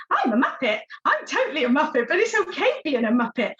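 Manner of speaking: high-pitched, happy cartoon dog voice; Odie. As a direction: turn the self-criticism into a silly, upbeat cartoon voice. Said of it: "turn it into something Odie might say".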